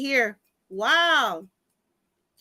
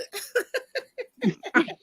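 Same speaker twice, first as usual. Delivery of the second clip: squealing laugh, high pitched; high burst of laughter; 10 semitones higher, 3 dB quieter than usual. Real, not acted. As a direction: let out a high delighted laugh. Amused by Brandon's joke.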